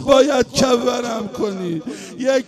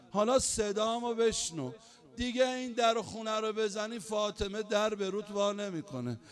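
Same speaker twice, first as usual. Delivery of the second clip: soft farewell; 3 semitones lower, 11 dB quieter than usual. Acted. Fatima's last farewell words.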